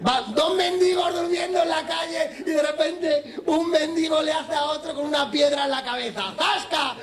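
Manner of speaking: más agudo y alto, riendo; cuéntalo a voz en grito; eufórico. Real, not acted. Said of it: "cuenta riendo y exaltado". (contando el chiste a gritos, riendo)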